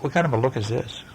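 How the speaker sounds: plain reading voice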